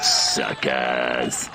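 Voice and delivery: low, scornful jeer; gloating alien sneer; sneering insult. Acted. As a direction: spit it at the crowd like a mocking alien. Alien mocking the crowd.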